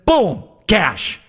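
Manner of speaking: high, punchy mock exclamation in a put-on accent; excited boom. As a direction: hit it like a big reveal. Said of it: "I don't know why I'm doing this accent".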